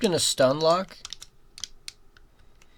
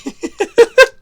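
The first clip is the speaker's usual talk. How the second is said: high giggling laugh; laughing again; 11 dB louder than usual. Real, not acted. Gamer still laughing at the game sound. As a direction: still cracking up.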